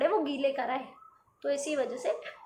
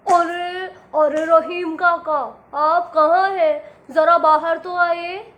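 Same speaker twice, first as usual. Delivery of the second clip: loud, slow call; 10 dB louder, slower than usual. A narrator's reading. Giant calling the old cobbler from outside. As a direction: shout into the shop.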